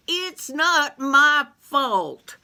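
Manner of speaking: slow, high, drawn-out whine; whiny protest. Acted. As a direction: whine it like a scolded kid. Beauregard whining.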